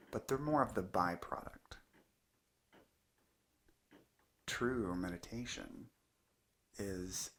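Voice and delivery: unhurried meditation teacher, long pauses between phrases; calm, measured guidance in short spaced phrases; guided meditation. Acted. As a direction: let each phrase settle into a long silence. Leads a guided meditation talk.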